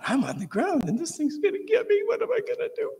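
very high squeaky panic; panicked thought. Retold or imitated. Lying on the ground by the cobra, a very high panicked thought. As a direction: let the fear run away with you.

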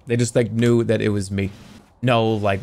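ordinary delivery

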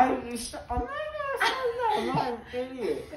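high giggly anesthesia voice; laughing, loopy and high; after wisdom teeth. Real, not acted. Loopy giggly outburst, high pitch.

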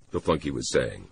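usual speaking voice

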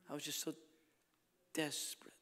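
hushed whisper of her desperation. Retold or imitated. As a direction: whisper it, drawing them in. Repeating her words as whisper.

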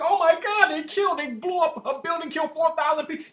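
high-pitched mock panic; hammed-up shocked outcry; mock horror. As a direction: imitate someone squeamish who is appalled. Mimicking squeamish people's horror.

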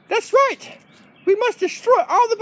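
high, zealous little dog; Oliver. Pretend. Oliver vows to destroy bugs, high and zealous.

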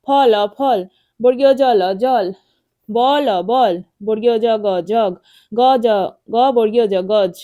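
loud chanted spelling from ফল to গজ, each word blended after its letters; spelling drill. Shown as a demonstration. Teacher leading children in reading, spelling drill. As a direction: call out the letters, then land on the whole word, like ফল. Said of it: "এভাবে পড়তে হবে".

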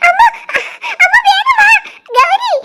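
high laughing shouts; giggly frightened shouting. Acted. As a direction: shriek for them to come, laughing through it. Calling everyone to hide, giggling.

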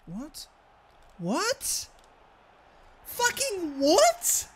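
real stunned shout at the game; building disbelief. Real, not acted.

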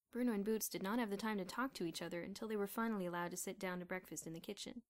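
usual speaking voice

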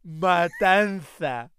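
"Matanza" muy lenta y fuerte; imitando la película. Retold or imitated. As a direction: repite la palabra del doblaje, alargándola con fuerza. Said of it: "ahora estamos con". (imitación arrastrada, a pleno volumen)

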